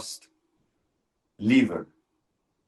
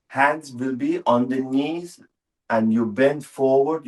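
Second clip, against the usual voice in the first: ordinary talk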